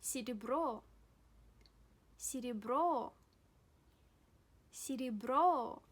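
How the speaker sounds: "серебро" with the last O long and loud; teacher's emphatic sidiBROO for "серебро"; stress on final O. Shown as a demonstration. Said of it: "the stress falls on O".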